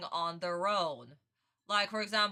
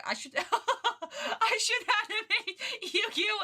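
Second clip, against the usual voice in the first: high, slow words broken by real laughter; laughing; 10 semitones higher, slower than usual. Real, not acted. Streamer cracking up at her crossover idea, laughing. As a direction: push the idea out through giggles.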